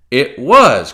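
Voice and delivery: loud, eager burst; quite excited. A narrator's reading. Silver excited as Jim names Pugh. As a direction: jump on it with excitement. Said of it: "now quite excited".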